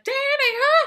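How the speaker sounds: high, loud frantic call for Danny; mock panicked call. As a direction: shriek his name in panic. Wendy screaming for Danny.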